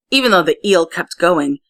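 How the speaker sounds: usual speaking voice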